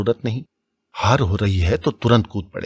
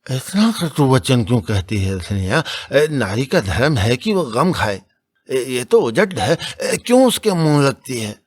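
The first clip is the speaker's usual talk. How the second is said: put-on scolding old priest; 5 semitones higher, 3 dB louder than usual. A narrator's reading. Narrator voices Datadin.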